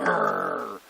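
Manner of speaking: low rolled growl; growling like a guard dog. Acted. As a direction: imitate a dog's warning growl. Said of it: "so he growls".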